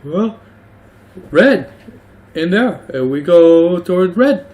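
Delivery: high, loud dog voice; a simple dog pointing the way. Acted. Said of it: "he's like".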